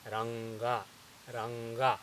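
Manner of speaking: "ranga" with a velar ng before the g; correct velar nasal. Shown as a demonstration. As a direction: say "ranga" with the ng of sing. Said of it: "but this is ranga".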